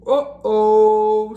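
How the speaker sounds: loud worried uh-oh; trouble's coming. Acted. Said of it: "said Splat".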